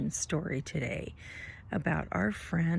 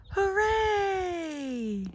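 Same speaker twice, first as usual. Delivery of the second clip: high shouted hooray; cheer; 12 semitones higher than usual. A narrator's reading. Skeleton free of hiccups, a shouted cheer. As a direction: cheer out with joy.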